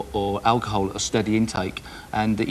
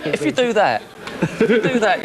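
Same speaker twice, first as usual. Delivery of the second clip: laughing; 9 semitones higher, 5 dB louder than usual. Real, not acted. Laughing setup of a joke.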